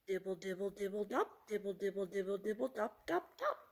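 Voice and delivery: rhythmic pattering words; rain dripping. A narrator's reading. Reader imitates rain for children, rhythmic dripping. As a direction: patter it out like raindrops. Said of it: "He can sound like the rain".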